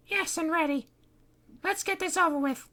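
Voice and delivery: put-on put-upon girl humouring Fred; Kitty's voice. Kitty voice held.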